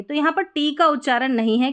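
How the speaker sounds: ordinary talk, nothing marked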